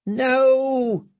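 high, broken mumble; frightened mumble. Acted. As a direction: moan it out in fear. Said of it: "he mumbled".

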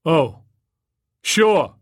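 loud, high, obliging boom. A narrator's reading. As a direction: agree loudly, still on loudspeaker. Turtle still booming over speakers.

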